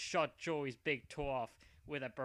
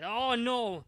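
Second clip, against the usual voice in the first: dismayed cry; 8 semitones higher, 8 dB louder than usual. Acted. Hero dismayed, powers fail.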